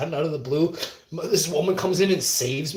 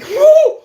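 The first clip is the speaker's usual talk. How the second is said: very high, loud "whew"; high whoop; 14 dB louder than usual. Retold or imitated. Reacting to the woman on the bus, high mock whoop. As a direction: whoop it out in falsetto.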